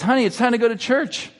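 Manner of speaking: a hint of a sing-out mom calling "honey"; lightly voiced mother. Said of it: "a mother goes to a son and says".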